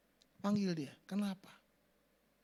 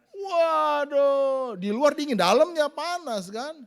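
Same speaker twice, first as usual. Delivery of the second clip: much louder and higher, heated; 9 semitones higher, 12 dB louder, much slower than usual. Shown as a demonstration. Preacher showing a high, loud, heated tone of voice. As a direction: raise the pitch and volume like a heated home. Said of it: "nadanya ngomong tinggi terus".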